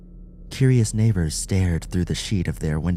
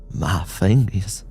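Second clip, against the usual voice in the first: awed drugged man; 4 semitones higher, 6 dB louder than usual. Acted. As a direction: marvel like a child at a trick.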